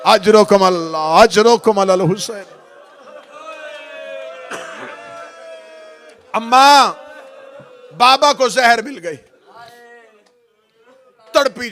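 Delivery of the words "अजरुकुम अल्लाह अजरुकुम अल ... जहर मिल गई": loud lament cry; mourning call. Acted. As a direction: call out the grief. Lament calls.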